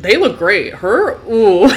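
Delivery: loud, swooping mock appraisal; mock reaction. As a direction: swoop up high on the disgusted aside.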